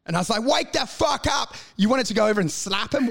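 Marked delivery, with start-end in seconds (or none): 0.4-1.6 raised, slower exasperated cry; high, drawn-out mock yell; mock outburst. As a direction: shout it as if rousing a sleeper. Retold outburst at a dull interviewer.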